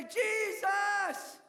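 high, loud desperate cry for help; desperate shout. Acted. Blind beggar shouting to Jesus for mercy, loud desperate cry. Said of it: "All he could do is say".

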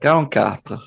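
"Quarante-quatre" said with a playful Italian accent; mock Italian accent. Shown as a demonstration. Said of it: "he said it in an Italian accent".

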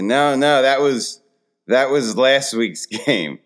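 insistent dad explanation. Retold or imitated. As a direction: correct the child emphatically. Dad explains again to confused daughter.

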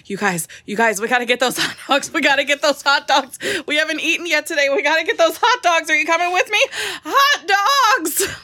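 rising, frantic, breathless begging; frantic bird voice. Acted. Bird raving about hot dogs.

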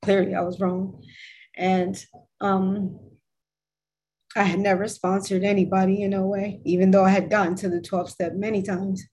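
normal talking voice

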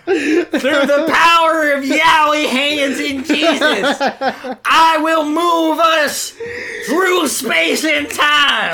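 high, slow, shouted preacher voice; mock preacher. Co-host joining in as a booming televangelist. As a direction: proclaim it like a faith healer.

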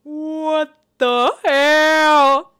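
very loud, drawn-out shocked cry; stunned disbelief. Real, not acted. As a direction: stretch every word in loud, high disbelief. Stunned reaction to creepy submission.

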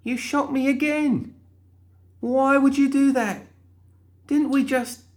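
shrill exasperated complaint; frustrated outburst. Acted. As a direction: wail in outraged disbelief. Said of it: "Molly sighed in frustration".

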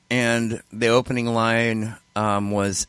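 ordinary delivery

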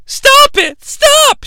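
high-pitched mock pleading; begging woman. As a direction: squeal it like a frightened woman. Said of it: "she begs".

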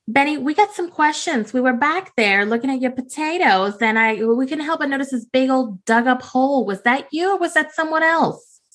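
high, sing-song flirty southern lady; flirty Belinda. Acted. Belinda sweet-talking the farmer, high and coy. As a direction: coo his name up high like a flirt.

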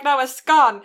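correcting, "scone" rhymes with "gone"; short-O scone. Retold or imitated. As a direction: stress "scone" as "skon".

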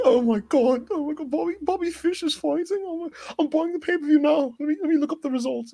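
high, fast mock fangirl squeal; imitating excited Charlie. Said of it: "Such bad accent".

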